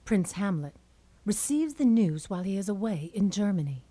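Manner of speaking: ordinary talk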